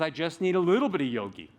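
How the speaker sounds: ordinary talk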